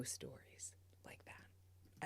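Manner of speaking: soft breathy whisper aside. Real, not acted. Wife whispering to the host that she has no such stories. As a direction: drop to a whisper as a confession.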